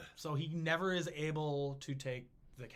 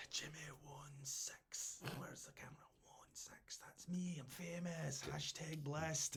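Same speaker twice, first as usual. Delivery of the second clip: hushed, slow whisper; secretive aside to the camera; joking whisper about being famous; 10 dB quieter than usual. Pretend. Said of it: "whispering celebrity bit".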